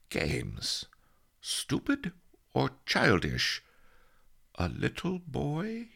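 halting sleep-talk, mostly pitched high; wondering dream mumble with long gaps; muttering in sleep. A narrator's reading. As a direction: puzzle it out in broken dreamy questions. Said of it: "Master Li muttered".

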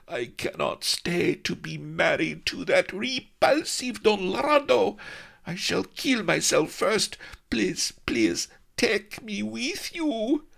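high, slow, yearning plea; pleading young maid. Acted. Melliflua clinging to Ben's neck, begging to go along.

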